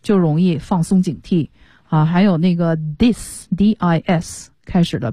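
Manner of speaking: ordinary talk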